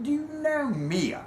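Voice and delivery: high, drawn-out British voice; British accent. Retold or imitated. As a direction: mimic a little British man, slow and high. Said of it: "a little British man walks up".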